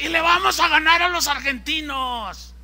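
high, loud battle cry; boastful rally shout. Retold or imitated. Organizer promising the crowd a football win, boastful shout. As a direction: rouse the stands against the rival team.

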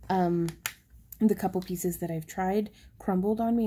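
usual speaking voice

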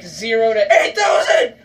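rising into a loud, incredulous yell; exasperated shout. Real, not acted. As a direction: yell the figure in disbelief. Exasperated about score jump.

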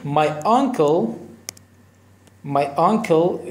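"my uncle" drawn out slowly; slow demo. Shown as a demonstration. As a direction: say it slowly for learners. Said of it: "slow teaching demo". (آهسته و کشیده)

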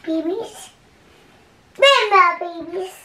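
high, sing-song cooing. Real, not acted. Cooing at old baby photos: high sing-song. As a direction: coo over them like a fond mum.

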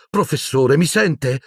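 high, urgent call of mi sente; repeated loudly. A narrator's reading. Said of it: "ripeté forte".

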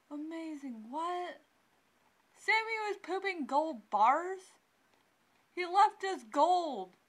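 high, incredulous "what?" building to amazement; astonished disbelief. Real, not acted. Player discovering her dead cat left gold, amazed. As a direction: gasp at the absurd news.

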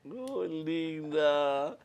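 high, slow, drawn-out cooing; gushing affection. Real, not acted. Host responding to a grateful guest, gushing fondly.

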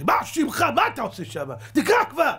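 high, loud impatient demand; impatient yell. Retold or imitated. King yells at stalling scribe, impatient and loud. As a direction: bark at him to read already.

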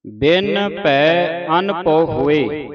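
ਬਿਨ ਭੈ ਅਨ ਪਉ ਹੋਏ said slowly and loud, with a pause after ਹੋਏ; pause after hoe. Shown as a demonstration. Teacher showing the sangat where the pause falls, slowly. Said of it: "ਹੋਏ ਤੇ ਵਿਸਰਾਮ ਹੈ".

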